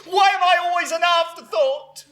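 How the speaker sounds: wounded protest. Acted. Mother complaining to her son of being slighted, aggrieved outcry.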